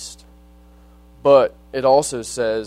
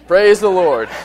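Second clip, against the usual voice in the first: much higher, loud praise breaking into a laugh; jubilant shout; 6 semitones higher, 9 dB louder than usual. Retold or imitated. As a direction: cry it out in triumph, laughing. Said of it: "started jumping up and down and clapping".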